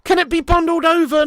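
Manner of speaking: loud high startled cry; surprised shout. Real, not acted. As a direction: gasp out loud at the sudden chance. Sudden chance in the box.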